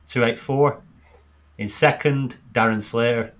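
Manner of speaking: loud announcer readout of standings; dreary voice. Acted. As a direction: read the table out like a results show. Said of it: "they've got my dreary voice to read it out".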